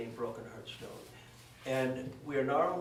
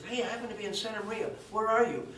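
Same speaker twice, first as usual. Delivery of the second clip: excited call; 7 semitones higher, faster than usual. Retold or imitated. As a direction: rattle it off, eager, rising in volume. Imitating Mitz calling.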